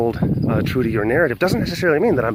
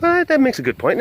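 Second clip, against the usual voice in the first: high-pitched surprised concession; sudden light-bulb reaction, pitched way up; mock realisation; 12 semitones higher, 4 dB louder than usual. Reacting to a viewer's good point, pleasantly surprised.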